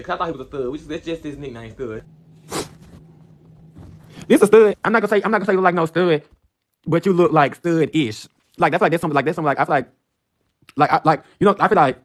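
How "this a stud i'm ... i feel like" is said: excited outburst. Acted. Excited outburst about a guy.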